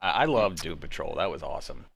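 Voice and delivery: ordinary talk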